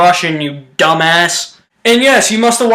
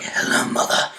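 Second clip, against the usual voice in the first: soft, whispered and uneasy; creeped-out whisper; 10 dB quieter than usual. Retold or imitated. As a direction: breathe it out as if spooked by her. Crowley greets his mother, creeped out.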